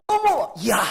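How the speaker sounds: loud, wowed outburst; amazed exclamation. Retold or imitated. Preacher recalls being stunned by a singer, amazed exclamation.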